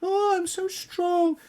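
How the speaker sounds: very high mock-admiring coo, slowing at the end; mock fans who praise her; sarcastic fawning. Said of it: "I'm always going".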